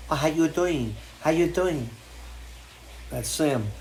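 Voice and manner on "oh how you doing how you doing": put-on light Mexican accent to the dogs; hint of a Mexican accent cooing at a dog. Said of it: "now we all speak like that to the dogs".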